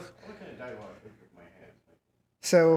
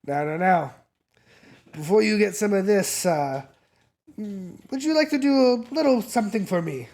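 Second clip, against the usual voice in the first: rising higher into a sly favour ask; scheming dealer; 4 semitones higher than usual. Acted. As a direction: hold them off, then bait the hook. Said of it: "he'll stop you and he'll say".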